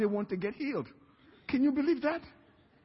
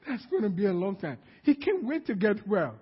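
ordinary delivery

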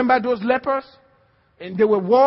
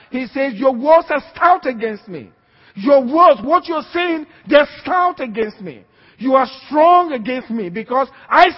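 louder, driving sermon voice; fiery preaching; 5 dB louder than usual. Acted. Pastor warns congregation about their words, fiery preaching. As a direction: press the warning home.

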